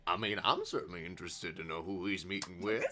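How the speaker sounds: lightly voiced soldier, low and quick; a hint of eager soldier, kept quiet; excited soldier. Soldier curious about the meeting, quietly excited. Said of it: "The soldier's quite excited by this".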